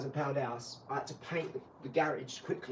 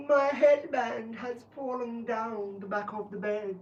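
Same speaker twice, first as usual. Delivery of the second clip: high, slow blubbering complaint; mock sobbing wife; 8 semitones higher, 5 dB louder, slower than usual. Wife explaining the lost headband, mock tearful whine. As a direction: whine it through fake tears.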